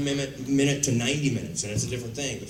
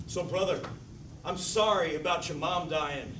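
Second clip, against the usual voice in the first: slow, overdone stage-play line; stagey theater delivery; 3 semitones higher, 6 dB quieter than usual. Shown as a demonstration. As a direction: overact it like theater acting. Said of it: "I have to deliver it like this".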